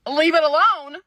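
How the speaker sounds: loud, high, disbelieving repeat of "Leave it alone?"; incredulous echo. Real, not acted. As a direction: fling his words back in outraged disbelief. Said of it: "I'm getting pretty heated".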